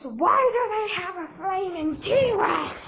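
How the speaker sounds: high, drawn-out exasperated cry; worked up. Acted. Villain leader returning to the flaming T-Rex, worked-up outburst. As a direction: wail the question in disbelief. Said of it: "Yes, I'm getting worked up".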